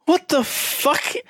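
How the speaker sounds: baffled. Retold or imitated. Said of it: "I was like".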